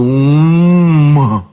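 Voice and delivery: long swelling closed-mouth "mmm"; long moan. A narrator's reading. Guard dropping head on hands after drinking, long moan. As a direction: moan through closed lips, drawn out. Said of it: "и опять сказал".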